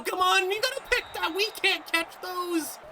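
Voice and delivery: high, pleading groan at the dropped pick; exasperated plea. Real, not acted. Gamer groans at his defenders, high and pleading. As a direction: beg them to make the catch.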